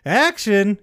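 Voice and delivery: loud call of action. Retold or imitated. Director cueing the stunt, loud call. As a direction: shout the cue. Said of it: "Stuntman puts the head".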